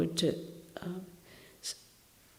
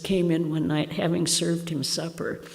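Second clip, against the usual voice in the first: ordinary delivery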